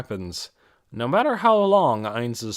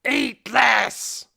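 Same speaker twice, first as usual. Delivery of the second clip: much higher, louder throaty yell; throaty goblin scream; 11 semitones higher, 4 dB louder than usual. Acted. Goblin lunging at Peter, throaty screaming. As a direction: scream it like a snarling goblin attacking. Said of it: "its foul, throaty scream".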